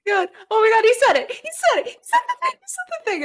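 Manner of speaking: very high-pitched excited squealing; fan squeals. Acted. Fans hear the idol's catchphrase, squealing with excitement. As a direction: squeal it over and over like thrilled fans. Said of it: "there's, like, some squeals".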